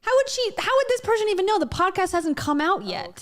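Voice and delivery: real incredulous outburst. Real, not acted. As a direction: fire off the question in disbelief. Incredulous reaction.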